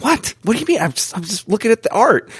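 high-pitched mock-innocent surprise; retold baffled protest. Telling how they answered their companion, high baffled protest. Retells own clueless reply to her.

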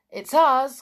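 loud, high, bright call through the door; cheerful call. Acted. Julian cheerfully calling through the door to Martin. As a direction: call out gladly to a friend indoors. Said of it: "called Julian cheerfully".

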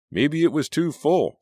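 put-on high, loud kid voice from the crowd; other kid. A narrator's reading. Kid in the crowd offers a guess, high and loud. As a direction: pipe up from the crowd.

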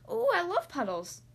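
high, delighted little-duck squeal; excited yell. A narrator's reading. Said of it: "Thistle yelled".

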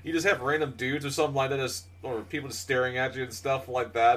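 normal talking voice

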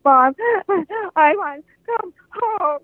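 high, slow tearful plea; crying into the payphone to mom. Retold or imitated. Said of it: "I was just crying my eyes out".

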